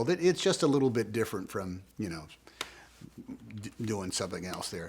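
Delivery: ordinary delivery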